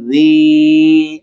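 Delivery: زی drawn out with a soft zaal. Shown as a demonstration. Teacher repeats زی slowly for students. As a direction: stretch زی gently, soft zaal. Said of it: "زال نرم پڑا جاتا ہے".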